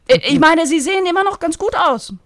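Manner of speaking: high, loud and brisk; eager agent. Acted. Bettina recalling the diva's films, high and eager. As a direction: rattle off her memories eagerly. Hasty correction after kick.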